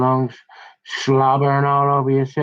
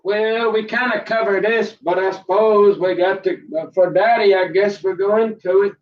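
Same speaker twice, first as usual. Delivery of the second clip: put-on reluctant kid voice; 6 semitones higher than usual. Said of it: "So you say".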